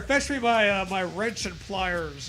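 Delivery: ordinary talk, nothing marked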